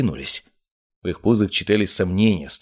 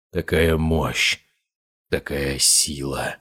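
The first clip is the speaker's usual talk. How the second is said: lower, slow, resonant voice; deep resonant voice; 4 semitones lower, much slower than usual. A narrator's reading. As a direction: let the voice drop deep and ring. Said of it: "стал более глубоким, резонирующим".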